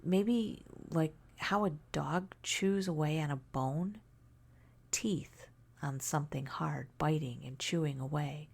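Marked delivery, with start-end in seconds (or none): none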